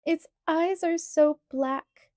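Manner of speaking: lightly voiced crying little girl; sobbing. A narrator's reading. Laura answers Ma through tears, slow and high. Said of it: "Laura sobbed".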